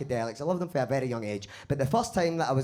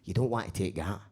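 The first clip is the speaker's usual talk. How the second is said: low firm warning; 7 semitones lower, 3 dB quieter than usual. Retold or imitated. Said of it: "he went".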